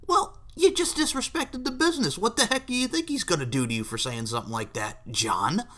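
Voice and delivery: opens very high, then settles down; mock scolding. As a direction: scold him in mock disbelief. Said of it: "and I'm like".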